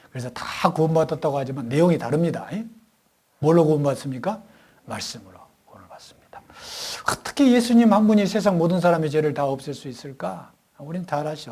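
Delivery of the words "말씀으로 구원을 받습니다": hushed, breathy whisper; whispered answer. Acted. Preacher whispers the answer to the congregation. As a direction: drop to a secret whisper.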